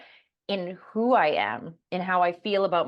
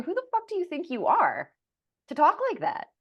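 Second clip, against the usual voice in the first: much higher, mocking scold with stress on fuck; scolding mother voice; 11 semitones higher than usual. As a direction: throw the mother's contempt back, pitched up. Inner mother voice attacking her.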